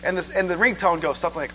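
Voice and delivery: ordinary talk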